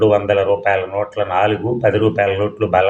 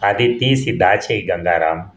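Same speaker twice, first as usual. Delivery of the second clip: ordinary delivery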